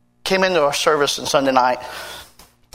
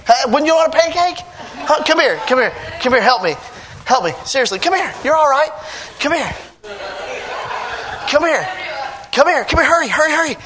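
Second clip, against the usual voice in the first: excited pancake giveaway; 8 semitones higher than usual. Pretend. Pastor acting out a pancake run, excitedly calling his helper. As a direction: hurry the helper along, all thrilled.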